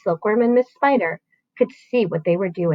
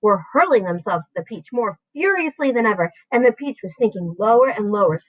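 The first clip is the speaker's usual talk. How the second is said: plain reading voice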